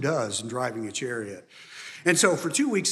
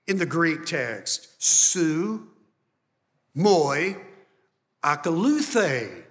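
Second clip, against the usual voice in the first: loud, raised preaching to the congregation; full-force preaching; 8 semitones higher, 6 dB louder than usual. Acted. Pastor preaching to the church, loud and driving. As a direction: preach it out with fire and conviction.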